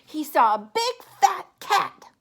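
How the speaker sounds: plain reading voice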